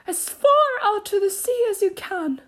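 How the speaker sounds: high, loud creature call; excited high turtle voice; Mock Turtle. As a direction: call it out high, as if across the water. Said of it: "the Mock Turtle went on".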